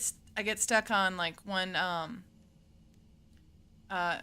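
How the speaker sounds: usual speaking voice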